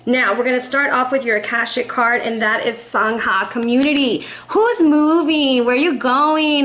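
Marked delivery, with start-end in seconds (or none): 4.5-6.6 loud, higher and slow teasing question; playful excitement. Acted. Reacting to the community card with viewers, playful loud teasing. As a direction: tease like you want to tag along.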